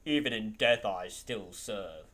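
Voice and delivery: slow, low gruff space marine; low, slow, gruff soldier. Acted. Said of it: "I also got a Space Marine voice".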